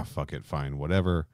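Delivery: ordinary delivery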